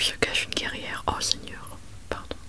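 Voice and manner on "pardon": usual speaking voice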